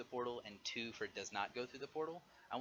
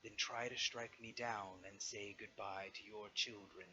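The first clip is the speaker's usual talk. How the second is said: dragon voice; slower than usual. Acted. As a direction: speak slowly like a weary old dragon. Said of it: "Safaraktar's voice calls out again and says".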